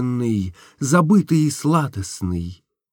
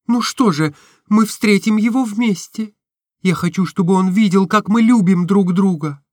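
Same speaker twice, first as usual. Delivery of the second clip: put-on higher, lighter girlish voice for Nastenka; Nastenka's voice; 10 semitones higher, 4 dB louder than usual. A narrator's reading. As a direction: voice the young woman, tender and open. (голос Настеньки, выше и легче)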